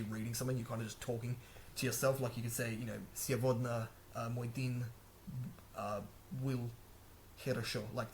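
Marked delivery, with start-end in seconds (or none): 3.1-7.8 slow, hesitant Russian broken by long pauses; halting beginner Russian. Shown as a demonstration. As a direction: stumble through simple Russian, searching for each word. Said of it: "that was just me freestyling".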